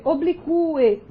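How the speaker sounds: oblique as o-bli-CU-e; hiatus, stressed U. Shown as a demonstration. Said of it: "sílaba tônica forte e esse U".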